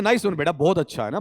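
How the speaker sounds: ordinary talk, nothing marked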